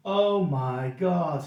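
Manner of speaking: drawn-out, stunned oh my God; slow awed gasp. Retold or imitated. Landlord sees a seven-foot fan: slow awed oh my God. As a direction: look up at a giant, amazed.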